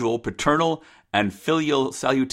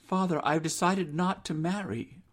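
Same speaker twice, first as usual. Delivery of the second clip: higher, softer daughter's voice; resolute daughter; 7 semitones higher, 7 dB quieter than usual. Acted. Rose refuses marriage to her father, soft and resolute. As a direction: decline politely but firmly, pitched up.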